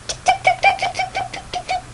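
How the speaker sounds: high-pitched tongue-click ticks; steady mechanical ticking imitation; scanner ticking. A narrator's reading. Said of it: "goes the scanner".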